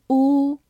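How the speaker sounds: 呜 held as a long oo; long oo sound. Shown as a demonstration. Teacher demonstrating the long vowel sound. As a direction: sustain the vowel 呜 like the o in move. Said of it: "字母O在这里发长音".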